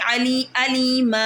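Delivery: अलीमा said slowly with a long final a; stopping on अलीमा with the alif drawn out; pausal form. Shown as a demonstration. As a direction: stretch the alif as you stop. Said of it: "अब मैं वक़फ़ कर रही हूँ".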